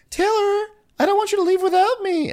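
very high, whiny mock squeal; Brandy impression. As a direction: plead in a squeaky caricature. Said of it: "Brandy squeals. That's how she talks".

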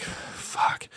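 whispered curse to himself. Retold or imitated. Finding the boot on his car, a whispered curse. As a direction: breathe out a frustrated whispered curse. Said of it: "I was like".

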